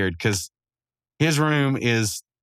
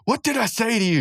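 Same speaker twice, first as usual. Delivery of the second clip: high-pitched indignant outburst; mock scolding; 12 semitones higher than usual. Muscle Man catching them at the computer, mock scolding. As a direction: scold them in a raised, put-on voice.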